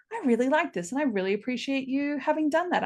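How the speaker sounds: lightly voiced thankful fan; fan praise. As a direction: voice a grateful stranger. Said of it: "a stranger from the internet is like".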